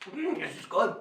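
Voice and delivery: soft talk through a bite of apple; mouth full. Real, not acted. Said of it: "Never talk with your mouth".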